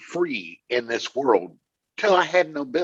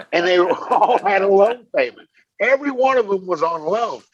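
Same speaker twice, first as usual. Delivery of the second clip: laughing at himself, higher and louder; 7 semitones higher, 6 dB louder than usual. Real, not acted. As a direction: chuckle through the confession. Laughing about his own foolishness.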